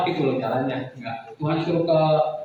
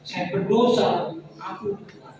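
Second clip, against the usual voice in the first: crying plea; 9 semitones higher than usual. Retold or imitated. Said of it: "Terus saya menangis".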